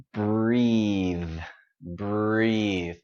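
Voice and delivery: "breathe" stretched long with a buzzing voiced TH; voiced ending. Shown as a demonstration. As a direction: hold the voice through the end of "breathe". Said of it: "Keep that voice going".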